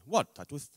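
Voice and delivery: son's lisp, What, Tatwith? with th for s; What, Tatwith? said softly with a childish lisp; a little boy lisping What, Tatwith?. Retold or imitated. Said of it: "he had a little lisp".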